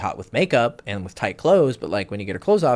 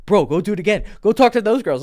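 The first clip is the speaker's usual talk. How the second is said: excited friend; 10 semitones higher, 4 dB louder than usual. Retold or imitated. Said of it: "he was actually excited".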